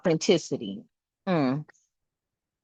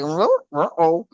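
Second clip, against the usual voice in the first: Scooby-Doo; 4 semitones lower, slower than usual. Retold or imitated. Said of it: "I'm up in here Scooby-Dooing".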